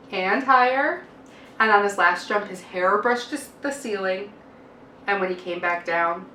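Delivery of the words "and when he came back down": usual speaking voice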